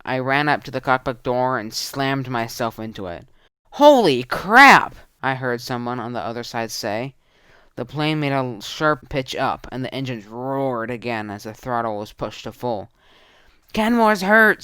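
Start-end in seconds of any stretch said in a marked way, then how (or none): 3.7-5.0 loud, much higher, slow exclamation; startled shout. Acted. Pilot startled by someone slamming the door, shocked yell. 13.7-14.6 loud, much higher alarm; urgent call. Acted. Tudor warning about injured Canmore, urgent shout. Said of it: "said Tudor".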